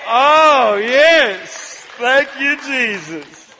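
high, loud thankful exclamation; loud relieved praise. Real, not acted. Preacher loudly thanking Jesus when the verse appears. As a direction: rejoice as the slide finally appears.

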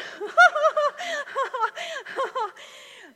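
a loud, high burst of laughter; high delighted laugh. Real, not acted. Preacher laughing at the crowd's victory, loud and high. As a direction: let a big joyful laugh out. Big laugh after story.

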